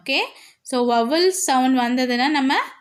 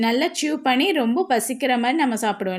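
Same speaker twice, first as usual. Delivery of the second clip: normal talking voice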